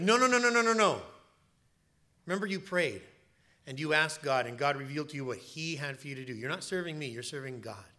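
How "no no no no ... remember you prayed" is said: high, loud run of no's; insistent, rapid-fire no-no-no; emphatic refusal. Acted. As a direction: shut the complaint down, high and loud. Acted cutting off of complaint.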